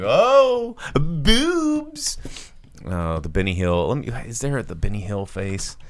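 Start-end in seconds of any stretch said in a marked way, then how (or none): 0.0-2.2 mock leering gasp. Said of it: "and he's like".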